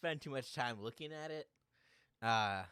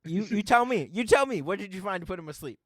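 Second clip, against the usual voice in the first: exasperated shout; 5 semitones higher, 13 dB louder than usual. Real, not acted. Exasperated GM pushes back.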